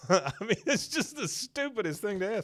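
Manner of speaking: high laughing remark; giggling disbelief. Real, not acted. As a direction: laugh through the words. Laughing at the joke.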